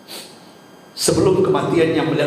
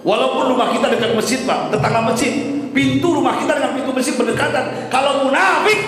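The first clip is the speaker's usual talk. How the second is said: impassioned preaching; 11 semitones higher than usual. Real, not acted. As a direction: preach it out, pitched high. Preaches at raised pitch.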